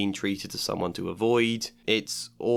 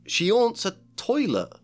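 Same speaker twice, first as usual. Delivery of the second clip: incredulous Harry; 10 semitones higher, 3 dB louder than usual. Acted.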